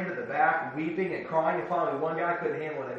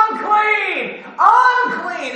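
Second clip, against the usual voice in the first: a much higher, louder wail of Unclean; loud anguished outcry; crying out; 13 dB louder, much slower than usual. Acted. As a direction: call out the warning as a leper on the road. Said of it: "they would cry out".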